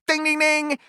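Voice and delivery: high, sing-song winner's chime; playful prize-bell imitation; mock bell ding. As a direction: ring it out like a game-show bell. Mock game-show bell.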